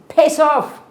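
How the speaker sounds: loud, sharp shout of a stranger telling her to go away; hostile shout. Retold or imitated. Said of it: "he said".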